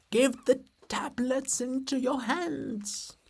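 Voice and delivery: high, slow and far-off, like voices from a distance; distant chorus. Acted. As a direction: sing it out as if from far away across the river. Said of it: "From far away, barely audible".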